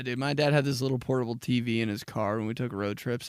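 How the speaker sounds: ordinary delivery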